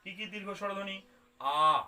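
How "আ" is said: আ held long to show a long vowel; long drawn-out আ. Shown as a demonstration. Teacher stretching আ to show its length. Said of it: "অনেক সময় লাগছে".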